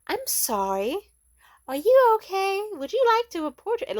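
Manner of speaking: mocking, high, syrupy fake concern; fake apology. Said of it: "she did that fake ass".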